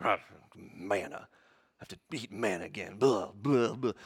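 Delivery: low, soft mock muttering; discontented mumble, acted out; muttering grumble. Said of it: "someone's just muttering under their breath".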